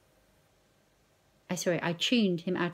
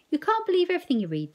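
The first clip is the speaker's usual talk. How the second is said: scolding dad; 10 semitones higher, 7 dB louder, much faster than usual. Acted.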